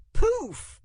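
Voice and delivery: loud, high exclaimed burst; magic poof. A narrator's reading. Narrator as the spell lands, exclaiming. Imitating the spell taking effect.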